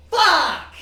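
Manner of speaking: high, loud single curse; frustrated yell. Real, not acted. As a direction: cry out in exasperation. Curse after losing in game.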